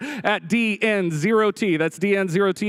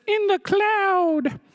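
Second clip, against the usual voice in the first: dreamy high voice; 13 semitones higher, much slower than usual. Pretend. Describing the cloud whimsically.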